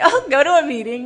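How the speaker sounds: a breezy brush-off; dismissive sponsor quote. Retold or imitated. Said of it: "And they'd say".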